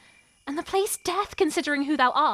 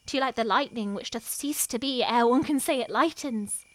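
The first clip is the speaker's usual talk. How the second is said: ordinary delivery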